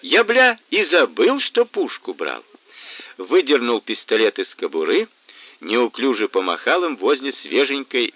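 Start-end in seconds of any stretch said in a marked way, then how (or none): 0.0-2.4 high, loud then slowing; glad drunk exclamation. A narrator's reading. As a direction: remember the gun with glee. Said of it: "радостно заорал Кацуба". (радостно, пьяно)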